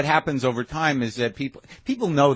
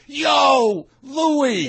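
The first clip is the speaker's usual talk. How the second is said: high, loud "Yo, Louis!" called across the street; street shout; 12 semitones higher, 4 dB louder than usual. Retold or imitated. Rowdy fan spotting the comic on the street, yelling his name. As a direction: holler at the star like a rowdy fan. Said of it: "There could be guys who are like".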